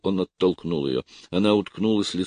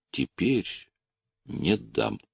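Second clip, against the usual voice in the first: soft, firm refusal with split-up words; firm, measured; 5 semitones higher, 4 dB quieter, much slower than usual. A narrator's reading. Officer refuses Lucy the letter, firm and measured. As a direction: separate each word, quietly resolute. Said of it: "чеканя слова, твёрдо сказал".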